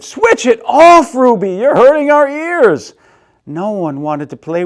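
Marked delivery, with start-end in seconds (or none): 0.0-2.9 put-on kids shouting. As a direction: shout her down together.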